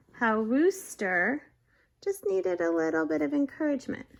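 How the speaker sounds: usual speaking voice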